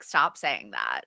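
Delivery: low slow mock boss voice. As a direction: drop low like a stern senior. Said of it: "people that I report to are like".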